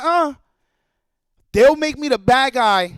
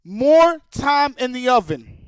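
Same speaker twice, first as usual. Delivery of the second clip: drawn-out mocking "more time"; slow sarcastic repeat; slower than usual. Real, not acted. Streamer repeating devs' excuse, slow and scornful. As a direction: slowly lean on "more" in disbelief. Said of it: "Ten years".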